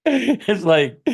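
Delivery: laughing, high and bright; real laugh breaking into the words. Real, not acted. Laughing at Judge Judy story.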